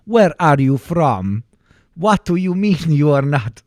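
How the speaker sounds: loud, exaggerated Swedish lilt; loud mock Swedish singsong; Swedish accent. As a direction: question them in a hammed-up Swedish accent. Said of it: "My accent is getting better".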